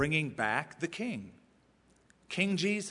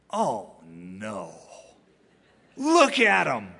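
scoffing unbeliever; 4 semitones lower, 7 dB louder, slower than usual. Retold or imitated. Preacher voicing an unbeliever watching Easter crowd, scoffing. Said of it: "an unbeliever would look at you Easter morning".

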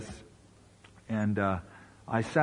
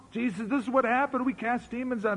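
high, excited retelling of a report; pumped up; 9 semitones higher, 4 dB quieter than usual. Retold or imitated. Disciples reporting back to Jesus, imitated as excited. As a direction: voice excited disciples bursting with news, pitched high. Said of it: "They were all excited".

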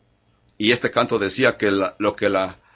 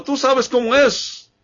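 much higher, airy put-on voice mimicking excuse-makers; mocking excuse; 14 semitones higher than usual. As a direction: squeak it out like a shrugging gossip. Final de la excusa imitada. (voz fingida mucho más aguda, burlona)

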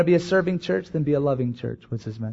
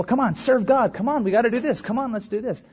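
mock pushy urging; 6 semitones higher than usual. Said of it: "Not of telling people".

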